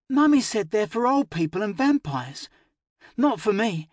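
put-on high, stubborn child voice; little girl. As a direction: state it like a defiant little girl. Child repeats mother's words.

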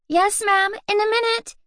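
put-on high girlish voice, still half awake; sleepy girl's reply. A narrator's reading. Louise answering her mother's knock, drowsy. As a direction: answer Mama while rubbing sleepy eyes. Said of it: "said Louise".

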